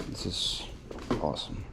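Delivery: barely voiced, holding back tears; fighting tears in a near whisper; choked up. Real, not acted. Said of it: "Try not to cry".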